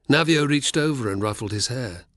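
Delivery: usual speaking voice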